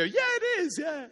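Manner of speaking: high, loud and thrilled; an excited squeal of yes; excited confirmation. Retold or imitated. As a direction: imitate the lady gasping yes. Lady confirms her name.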